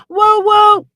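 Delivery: a hint of Marquez in a loud, high woo; Marquez's voice. Streamer imitating Marquez with a loud, high woo. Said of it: "In Marquez's, uh, voice".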